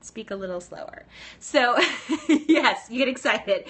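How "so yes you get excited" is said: laughing. Real, not acted. Host reading viewers' excited replies, laughing. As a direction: laugh through the words, loud and bright.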